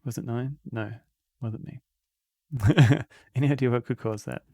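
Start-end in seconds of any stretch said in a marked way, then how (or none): 2.5-3.0 laugh. Real, not acted. Laughs at the joke.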